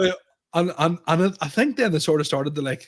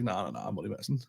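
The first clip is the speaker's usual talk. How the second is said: low, much softer retold retraction; subdued backtrack; 5 semitones lower, 11 dB quieter, faster than usual. Comedian retells taking back his boast, subdued. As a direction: drop the voice right down to take it back.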